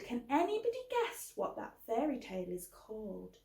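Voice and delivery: usual speaking voice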